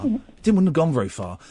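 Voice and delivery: ordinary delivery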